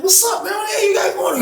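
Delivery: startled. Retold or imitated.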